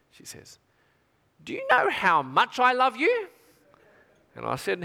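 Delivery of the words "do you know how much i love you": put-on high, slow sweet toddler; child's voice. Daughter coaxing her dad from the back seat, sweet and slow. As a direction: coax like a little girl.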